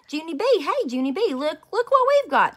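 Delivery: high, loud excited calling across the playground; hollering. Acted. Friends running up and hollering at Junie B, excited. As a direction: shout it out to her from far off. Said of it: "they hollered".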